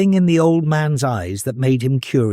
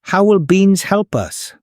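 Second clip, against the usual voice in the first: put-on skeptical boy; 7 semitones higher than usual.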